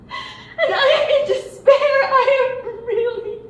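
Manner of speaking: high, loud, broken by sobs, slowing on "in despair"; crying in despair. Acted. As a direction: sob it out as the despair overwhelms her. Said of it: "Don't cry".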